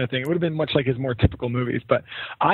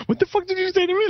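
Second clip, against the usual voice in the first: fast, very high-pitched offended squeal; mock outrage; faster than usual. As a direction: fire back like an insulted movie character. Retells outraged comeback while laughing.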